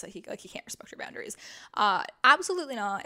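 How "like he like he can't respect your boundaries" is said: whisper. Real, not acted. Whispered remark.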